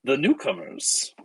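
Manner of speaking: high drawn-out 'Ah', then slow greeting; Count Strahd. Acted. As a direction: greet them slowly, savouring each word. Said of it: "he says".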